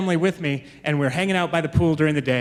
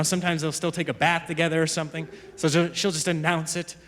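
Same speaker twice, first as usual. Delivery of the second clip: ordinary talk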